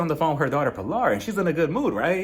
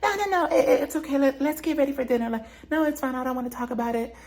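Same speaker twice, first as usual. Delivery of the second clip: high, sing-song fake denial, much higher; faux innocent denial; 10 semitones higher than usual. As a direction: play dumb in a bright falsetto as if nothing's wrong. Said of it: "she was just like".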